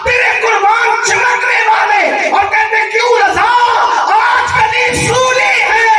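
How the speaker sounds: high, sustained declamation of devotional verse; impassioned naat recitation. Acted. Preacher reciting his naat couplets to the gathering, impassioned recitation. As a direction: ring each couplet out high to the crowd.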